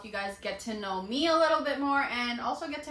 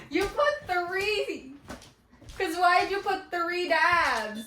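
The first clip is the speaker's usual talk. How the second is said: laughing; 8 semitones higher, 5 dB louder, slower than usual. Real, not acted. Teasing boyfriend about three dabs.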